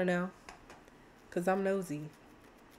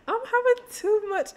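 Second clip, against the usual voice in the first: much higher and louder giddy squeal; 14 semitones higher, 6 dB louder than usual. Real, not acted. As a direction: squeal with delight. Squealing at the couple.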